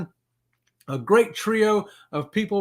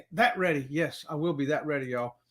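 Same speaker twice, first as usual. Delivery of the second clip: quiet; 4 semitones lower, 6 dB quieter than usual. Real, not acted. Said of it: "This ready".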